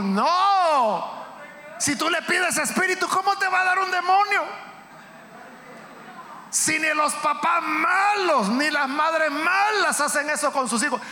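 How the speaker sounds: high, emphatic pulpit cry; impassioned sermon at full force; fervent preaching. Real, not acted. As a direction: refute it forcefully, stretching key words.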